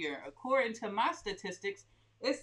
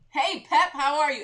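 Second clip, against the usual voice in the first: excited greeting; 6 semitones higher, 11 dB louder than usual. Real, not acted. Greeting a viewer.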